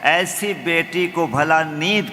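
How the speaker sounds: measured, declaimed verse; stately nazm recitation. Acted. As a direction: recite to the audience with weight.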